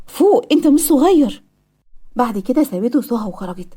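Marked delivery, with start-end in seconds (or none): 0.1-1.4 slow, emphatic, higher-pitched telling-off; mildly scolding, drawn out for emphasis; stern scolding. Acted. Nurse urging a patient to grow up, stern scolding.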